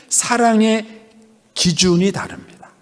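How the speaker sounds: ordinary talk, nothing marked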